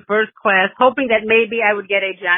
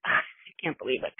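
hushed disbelief; 4 semitones lower, 10 dB quieter than usual. Retold or imitated. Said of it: "I was like".

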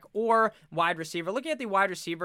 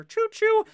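high, loud hammed-up train whistle call; train whistle choo-choo; 8 dB louder than usual. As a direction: toot it like a conductor on a hype train. Playing the hype train conductor.

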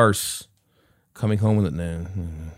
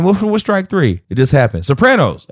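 higher and louder, building; excited tally; 10 semitones higher, 6 dB louder than usual. Real, not acted. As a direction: count off the strikes in disbelief. Excited tally of friend's strikes.

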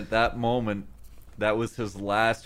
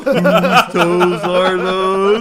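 slow, loud drawn-out meme line; meme voice quote; 5 semitones higher, 9 dB louder, much slower than usual. Retold or imitated. Echoing the monkey meme, slow and loud.

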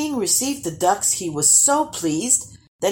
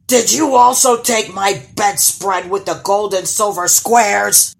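loud, accusing troll roar; bellowed in a menacing creature voice; 2 semitones higher, 7 dB louder than usual. A narrator's reading. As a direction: boom the question out like a monster. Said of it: "bellowed the troll".

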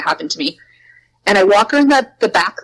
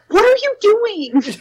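much higher, slow, drawn-out disbelief; incredulous; 13 semitones higher, slower than usual. Retold or imitated. Teacher glaring at her careless coworker, high and slow disbelief. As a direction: stretch it out in stunned disbelief. Dagger look at dumb teacher.

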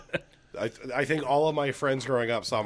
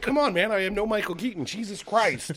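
high, raised-voice pleading; exasperated protest; 8 semitones higher, 3 dB louder than usual. Retold or imitated. Son to demanding dad, exasperated protest.

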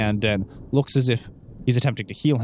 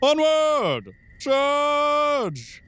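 battle cry; much slower than usual. Acted. GM voices Callahan charging.

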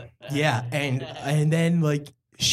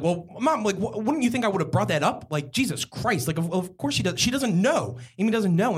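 exasperated; 8 semitones higher than usual. Retold or imitated. Exasperated retort to mom.

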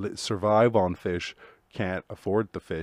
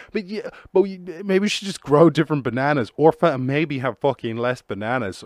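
mock pleading; 5 semitones higher, 4 dB louder than usual. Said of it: "And you're like".

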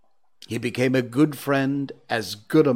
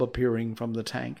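usual speaking voice

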